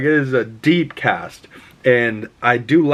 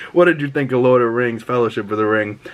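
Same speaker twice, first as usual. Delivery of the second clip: ordinary talk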